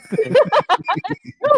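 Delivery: loud, very high laugh; high shriek of laughter. Real, not acted. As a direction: burst out laughing at the joke. Laughs at the joke.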